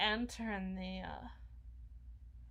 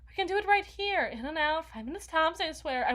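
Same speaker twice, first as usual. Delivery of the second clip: eager rush; 6 semitones higher, 5 dB louder, faster than usual. Acted. Eager pitch to her human.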